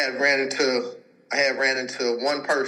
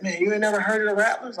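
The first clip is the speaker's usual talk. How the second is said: higher mock patient voice; 8 semitones higher, faster than usual. Patient voiced in retold story.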